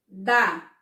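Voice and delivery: "Da" loud and standing alone; a firm, separate "Da" for pupils to count; isolated syllable. Shown as a demonstration. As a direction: punch out "Da" as one counted beat. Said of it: "falar a palavra pra vocês e contar".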